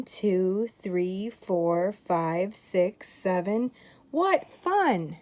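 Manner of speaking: ordinary delivery, nothing marked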